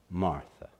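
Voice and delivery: soft, low and loving; tender, loving. Retold or imitated. Jesus gently answering Martha, soft and loving. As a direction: say her name with sweet love. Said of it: "with such sweetness and such love in his voice".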